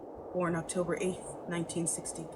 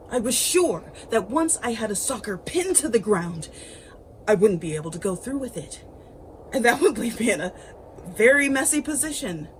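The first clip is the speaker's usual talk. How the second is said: agitated; 4 semitones higher, 7 dB louder than usual. A narrator's reading. Agitated worry, rising intensity.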